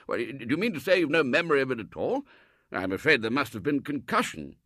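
baffled and worried; puzzled Colonel. Acted. Colonel worrying about Robert's concussion, fast and baffled.

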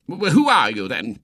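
irritated professor demanding an answer; irritable. A narrator's reading. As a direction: ask it with open irritation. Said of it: "said the professor irritably".

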